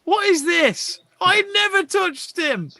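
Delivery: high, loud outraged protest; outraged disbelief. Real, not acted. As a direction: cry out in disbelief at the dive. Outraged at a dive.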